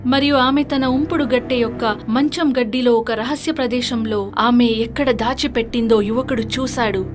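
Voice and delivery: ordinary delivery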